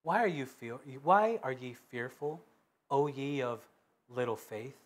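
hushed, tender reproach; calm gentle rebuke. A narrator's reading. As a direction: quietly chide the frightened men. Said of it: "And he said unto them".

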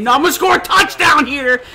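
cocky shout; defiant retort. Real, not acted. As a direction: insist defiantly. Defiant reply to viewers.

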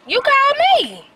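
high, loud protest; indignant surprise. Real, not acted. To her friend on the phone, high surprised protest. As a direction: insist she was the one calling.

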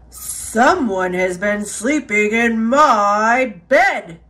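low, big bear bellow; Papa Bear. A narrator's reading. Papa Bear finding his bed slept in, bellowing. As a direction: bellow it deep, swelling toward "bed". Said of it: "Papa Bear bellowed".

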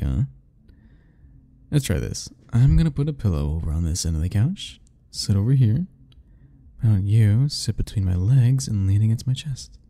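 ordinary talk, nothing marked

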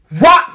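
loud, high "whop" impact noise; imitated smack. Telling how the thrown bottle hit, loud imitated smack. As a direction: punch out the sound of a hard hit.

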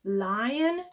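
ordinary delivery, nothing marked

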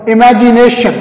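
normal talking voice

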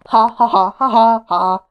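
loud spoken ha-ha-ha; gloating laugh. Real, not acted. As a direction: laugh it out in triumph. Mocking laugh after big win.

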